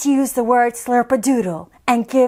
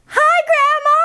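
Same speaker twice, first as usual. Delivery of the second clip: put-on much higher child yelling; calling out; 13 semitones higher than usual. Isabella yelling into the phone at Grandma. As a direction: yell it into the phone. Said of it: "yelling into phone".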